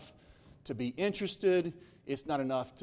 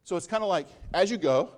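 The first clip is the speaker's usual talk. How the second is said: raised, louder sermon delivery; impassioned preaching; 4 semitones higher, 4 dB louder than usual. Real, not acted. Pastor pressing the message on listeners, raised and fervent. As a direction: keep the fire going.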